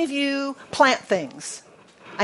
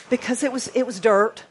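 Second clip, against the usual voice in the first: ordinary delivery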